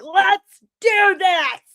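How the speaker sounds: high, loud, slow rallying shout; bursting into the studio ready to go; pumped-up cry. Retold or imitated. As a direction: charge in fired up. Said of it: "I'm like".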